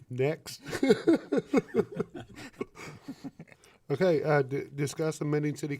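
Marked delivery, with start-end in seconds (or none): 0.7-3.5 high burst of giggling; laughing. Real, not acted. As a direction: break into embarrassed laughter. Giggling at her own mouthful.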